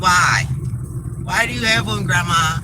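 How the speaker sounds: put-on high, loud puzzled little boy; grandson's voice. Grandson questioning grandma about her boyfriend. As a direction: demand it like a curious grandson. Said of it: "he'd have been like".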